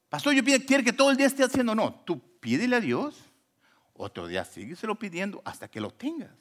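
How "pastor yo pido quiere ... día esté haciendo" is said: high, rushed protest; hurried high-pitched objection; mock complaint. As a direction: imitate a grumbling churchgoer, pitched up and fast. Mimics a complaining church member.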